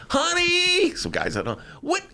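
high-pitched mock wife puzzling over the paper; Marge imitation. Imitating his wife Marge puzzling over the headline. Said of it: "That's my Marge imitation".

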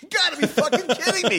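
half-laughing "you gotta be fucking kidding me"; laughing disbelief. Retold or imitated. Retold outrage, laughing.

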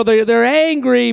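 normal talking voice

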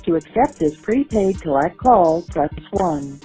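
recorded operator over a jail phone; automated prison phone prompt; payphone call. Real, not acted.

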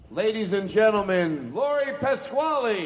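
announcer voice. Acted. Introducing a guest on show.

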